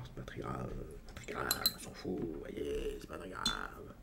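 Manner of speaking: deep, soft, unvoiced mumbling as Guy Bedos; politician-mocking comic's drone; Bedos impression. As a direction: drop way down and mutter it like the comedian. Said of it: "il imite quelqu'un".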